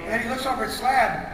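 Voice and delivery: ordinary delivery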